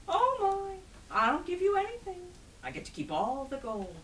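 high, loud, delighted game winner; gleeful trickster Herschel. Acted. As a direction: crow over the winnings in a high voice.

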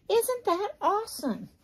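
high, bright gush of delight; thrilled by the outfit; excited. Real, not acted. Excited about outfit snaps.

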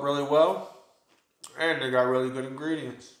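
eating chips between words; talking while chewing. Real, not acted. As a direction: mouth partly full. Seen 2: Holds corn chips by mouth, lips working while talking.

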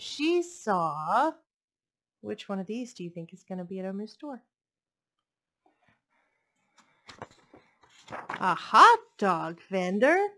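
lively storybook read-aloud; picture book read to a class; reading to children. A narrator's reading. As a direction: read it warmly to little listeners.

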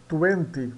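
"Twenty" with the W said like a U, "tuenti"; teacher modelling "Twenty"; pronunciation demo. Shown as a demonstration. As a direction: say "Twenty" as "tu-enti", W as an oo sound. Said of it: "o Y sempre vai soar como I".

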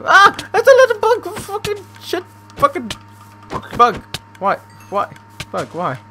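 shrieking, very high and loud; crying with rage. Real, not acted. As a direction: freak out screaming at a tiny bug. Startled by a bug in game.

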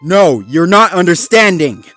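loud, high angry retort; frustrated shout; heated protest. Acted. Zuma argues with Marshall.

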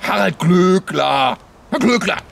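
slow booming name call; dramatic announcer. Acted. Dramatic announcement of celebrity news.

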